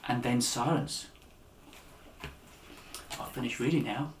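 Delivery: usual speaking voice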